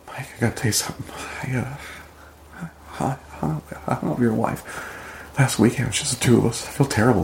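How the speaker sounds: hesitant, halting confession that starts almost whispered; a touch of an embarrassed man owning up to a friend; retold scene partner sheepishly confessing to Mike. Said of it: "Say the line again and be embarrassed".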